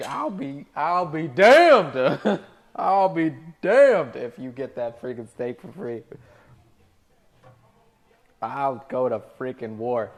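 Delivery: loud, stretched defiant vow; ready to fight for it; fired up. Real, not acted. Server to chat about the customer, loud and fired up. Heated declaration.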